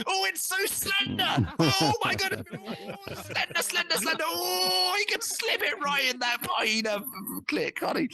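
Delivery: very high, slow, swooning put-on voice; camp falsetto swoon. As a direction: gush over slender in a shrieking falsetto. Camp falsetto swooning over Scott.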